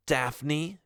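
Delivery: ordinary delivery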